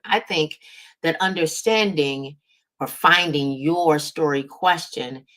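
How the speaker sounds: ordinary delivery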